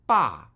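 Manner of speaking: short, emphatic falling "ba"; fourth tone. Shown as a demonstration.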